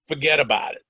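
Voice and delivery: put-on West Philly accent, "forget about it". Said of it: "I'll do this in my best West Philly accent".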